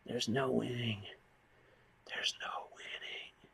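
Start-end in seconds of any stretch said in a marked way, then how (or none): 2.1-3.5 breathy whisper, slow. Real, not acted. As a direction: let it out as a defeated whisper. Repeated resigned line.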